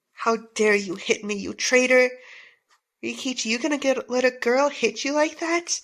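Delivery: ordinary talk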